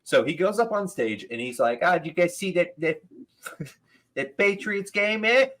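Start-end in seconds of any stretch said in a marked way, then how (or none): 1.8-5.5 bad Boston impression. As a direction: do a deliberately bad Boston accent, higher. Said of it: "I'm probably doing his voice worse".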